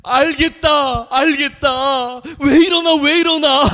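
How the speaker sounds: high, loud, slow protest; recruit yelling while hit. Retold or imitated. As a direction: yell it out while being beaten. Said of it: "맞으면서 하는 말이".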